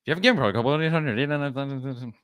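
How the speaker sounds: absurdly sped-up legal disclaimer; as fast as possible. Shown as a demonstration. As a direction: race through the fine print until words blur. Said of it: "as fast as I can".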